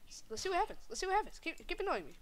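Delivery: high-pitched taunting character voice; high taunting dare. Acted. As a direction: goad him in a high voice. Daring provocation in character voice.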